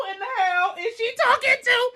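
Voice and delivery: high mock wail of disbelief; incredulous. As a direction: squeal the question in disbelief. Acts out disbelief at the news.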